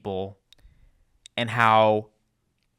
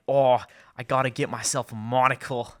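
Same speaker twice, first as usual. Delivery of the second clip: badly faked Penguin accent. Co-host imagines the Penguin needing a monocle, in a bad accent. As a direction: put on a clumsy accent for the Penguin. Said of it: "That was a really bad accent".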